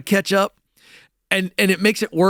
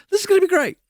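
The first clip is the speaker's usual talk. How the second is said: higher, cocky and sure of himself; cocky excitement; 13 semitones higher, much faster than usual. Retold or imitated. Naive newcomer boasting he'll be great, high and cocky. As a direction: sell the overconfidence, bouncing up high. Naive confidence before the fall.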